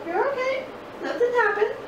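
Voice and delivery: high soothing reassurance; reassuring. Real, not acted. Reassuring toddler after bump.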